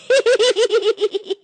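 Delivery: high cartoon cackle; greedy high cackle; gleeful cackle. Shows fish to wife.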